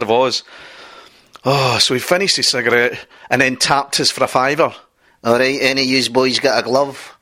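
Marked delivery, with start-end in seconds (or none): 5.2-7.2 rough Glasgow ned voice; Glasgow street accent. Retold or imitated. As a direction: play a cheeky Glasgow ned. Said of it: "Chav talk".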